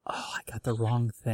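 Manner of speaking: whispered aside to self. Real, not acted. Host catching his own wrong link, whispered aside. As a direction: mutter the mistake under your breath.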